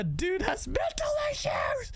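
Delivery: high, strained mock shout; all-caps shout. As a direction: yell it like an all-caps message. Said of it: "he says in all caps".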